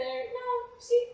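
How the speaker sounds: high, amused protest; laughing protest. Real, not acted. Playwright protesting the raunchy label, amused. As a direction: protest while still laughing.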